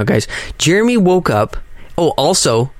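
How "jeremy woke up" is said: high wired announcement; jittery with morning energy; too much caffeine. Real, not acted. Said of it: "I'm so wired right now".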